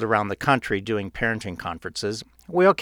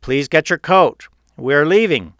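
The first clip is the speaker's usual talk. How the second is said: raised, higher, insistent father's voice; a bit louder; 4 semitones higher, 8 dB louder than usual. A narrator's reading. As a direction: repeat the request louder, firmly. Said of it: "a bit louder".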